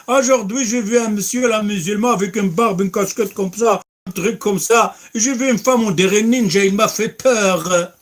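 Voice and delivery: high, loud jokey retelling; mocking storyteller. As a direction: ham up the mocker joking at dinner. Mockers joking to family at home.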